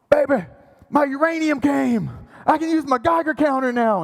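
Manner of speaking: bursting to show his wife; excited announcement. Retold or imitated.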